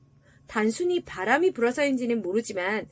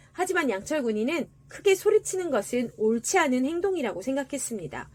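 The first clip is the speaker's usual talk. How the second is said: ordinary delivery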